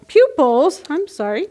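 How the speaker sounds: ordinary delivery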